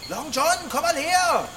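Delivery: high call across the grove. Acted. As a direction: call him over from a distance. Calling the cat from afar. (hoch rufend)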